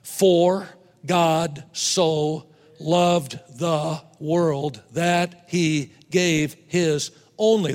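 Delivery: very slow, word by word, counting the syllables; slow scripture reading. Shown as a demonstration. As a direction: read the verse deliberately, each word weighed. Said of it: "By the way".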